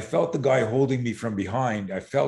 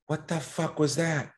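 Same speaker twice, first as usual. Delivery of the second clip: stunned disbelief; 6 semitones higher than usual. Retold or imitated. Boy alone after the fight, stunned. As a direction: ask it in dazed disbelief.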